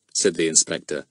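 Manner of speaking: plain reading voice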